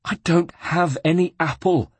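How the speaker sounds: sobbed. A narrator's reading. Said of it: "sobbed the monk".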